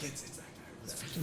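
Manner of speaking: hushed unvoiced tip-off; whispered snitch. Imagined snitching to guards, hushed whisper. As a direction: whisper it like ratting someone out.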